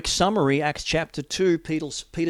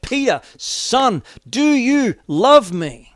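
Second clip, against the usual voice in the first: slow, high, loud plea; Jesus calling out to Peter with longing; slow, loud, high pleading question; pleading cry; 10 semitones higher, 10 dB louder, slower than usual. Acted.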